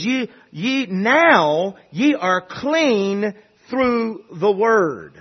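very slow, drawn-out scripture quote; stretched, emphatic preacher's reading; scripture declaration. Acted. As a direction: declare it word by word from the pulpit. Reads out Jesus's words to congregation.

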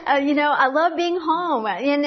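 laughing at herself. Retold or imitated. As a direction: laugh through the line. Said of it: "I would say".

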